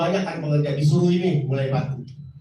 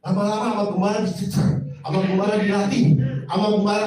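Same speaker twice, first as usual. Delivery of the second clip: usual speaking voice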